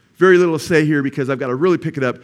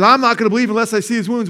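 raised, louder mock refusal; imitated stubborn doubt; 7 semitones higher, 6 dB louder, faster than usual. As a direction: dig in loudly as a doubter. Acting out Thomas refusing to believe.

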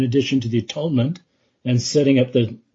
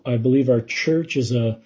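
ordinary delivery

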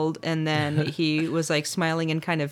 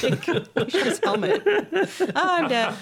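high, slow, overacted death; hammy dying extra; 13 semitones higher, slower than usual. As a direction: ham up a silly fake death.

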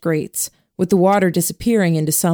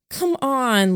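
coaxing plea; 7 semitones higher than usual. A narrator's reading. Lillian pleading with Jamie.

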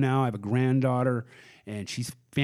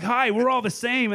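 high, bouncy mock-friendly hello; acted eager greeting; 11 semitones higher, 4 dB louder than usual. Stoned man greeting his namesakes, acted eagerness. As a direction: greet them like long-lost twins.

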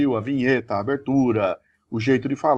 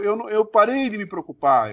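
usual speaking voice

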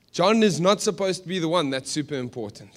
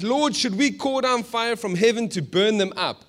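high, loud, zealous plea; worked-up, overexcited request; over-eager disciples; 6 semitones higher, 6 dB louder than usual. Acted. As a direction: ask it with hammed-up fiery eagerness. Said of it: "they said to Jesus".

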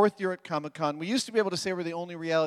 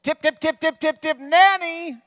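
high squeaky voice; 10 semitones higher, 6 dB louder than usual. Retold or imitated.

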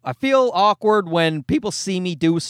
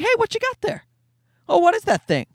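curious passerby; 13 semitones higher than usual. Retold or imitated. Passersby asking about camera.

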